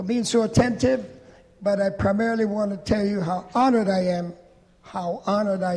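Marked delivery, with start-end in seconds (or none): none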